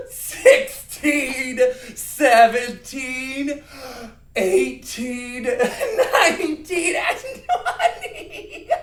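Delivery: high, loud count cracking up with laughter; uncontrollable laughter. Shown as a demonstration. Teacher leading a counting game, laughing uncontrollably. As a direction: play helpless giggles through every number. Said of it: "we can't control our laughter".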